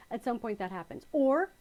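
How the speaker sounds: ordinary talk, nothing marked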